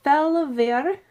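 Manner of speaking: Felver in a light, hammed-up Italian accent; a playful fake Italian accent on Felver; mock Italian accent. Reading a subscriber's name in a mock Italian accent. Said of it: "I'm giving everything an accent".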